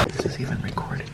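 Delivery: whisper. Real, not acted. Whispered aside.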